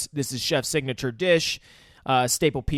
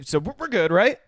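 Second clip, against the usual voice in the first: higher, wavering mock reassurance; unsure, seeking agreement; sorry self-convincing; 6 semitones higher than usual. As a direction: act out someone trying to convince himself it's okay. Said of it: "in such a sorry way convince themselves".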